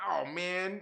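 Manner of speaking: normal talking voice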